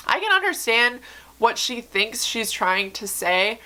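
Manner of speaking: ordinary talk, nothing marked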